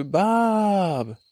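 big, high announcer reveal of the name; excited voice. Acted. Host revealing his guest Bob in excited voice. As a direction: shout the name like a game-show reveal. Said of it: "I was using my excited voice".

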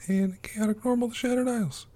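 title sung out high; high sing-song title. Acted. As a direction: belt the show title up high.

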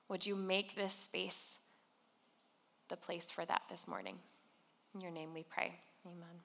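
soft, unhurried spoken prayer; quiet, reverent congregational prayer. Real, not acted. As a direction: pray gently, pausing between phrases, sinking to a hushed amen.

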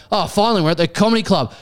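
high-pitched mock-outraged customer; abusive heckler. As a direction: heckle the wrong show in comic indignation. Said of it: "is still abusing me".

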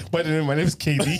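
ordinary talk

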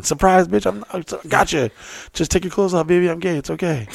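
high, teasing put-on voice; playful mock seduction.

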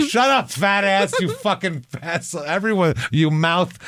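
high-pitched yell without the volume; mock yell. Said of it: "every guy has wanted to yell".

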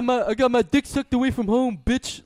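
hammed-up rapper boast; mock rapper line. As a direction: jeer it out like the rapper bragging. Mocking the rapper's lyric.

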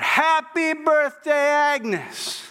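high, drawn-out surprise-party yell; slow, high-pitched shouted greeting. Acted. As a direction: stretch it into a crowd's celebratory holler. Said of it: "everybody yells out".

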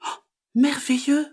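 very high, marvelling frog voice; delighted little frog. Acted. Frog believes magpie's trick, high delighted voice.

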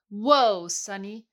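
loud call of Whoa, then drops back; calling to a horse. Acted.

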